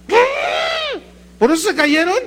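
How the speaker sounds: loud, high mocking question; mock incredulous. Real, not acted. Preacher mocking the wall-toppling idea, loud and high.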